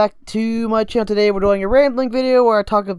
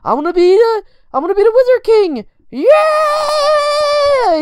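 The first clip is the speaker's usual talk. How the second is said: high, loud, over-the-top anime hero yell; strained high scream, dragging out the last word; mock screaming; 5 dB louder, slower than usual. Mocking an anime lead who screams all the time, high hammed-up scream. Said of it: "He just screams all the time".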